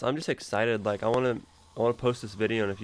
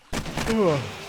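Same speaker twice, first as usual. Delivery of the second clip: long loud yawn, voice rising then falling; big yawn; 7 semitones higher, 4 dB louder than usual. Real, not acted. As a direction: let out a big sleepy yawn. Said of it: "Sorry, big yawn".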